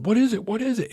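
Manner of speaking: alarmed question. Retold or imitated. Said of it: "we hear them in the front start going".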